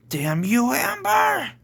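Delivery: rising to a high cry on the name; angry scream. A narrator's reading. Edward turns on the Queen Devil, screaming. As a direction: curse her, pitch leaping up. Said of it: "screamed Edward".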